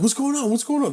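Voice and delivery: put-on high, urgent drunk-friend questions; retold agitated questioning. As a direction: act out confused friends rushing up.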